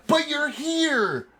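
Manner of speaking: high, drawn-out cry; slow, high crazed wail; mad elf. Acted. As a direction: stretch it out in elated madness.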